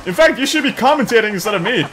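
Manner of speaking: high, loud mock amusement; sarcastic. Player telling off a laughing rabbit, sarcastic. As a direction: fake laugh into a loud, needling retort.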